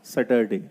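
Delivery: सैटरडे with the r, said low and soft; wrong Saturday. Shown as a demonstration. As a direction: say सैटरडे with a rolled r.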